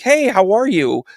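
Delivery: high, slow put-on hello; fake friendly hello. Psychic faking recognition of a fan, high slow hello. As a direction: pretend you know them. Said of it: "I'll be like".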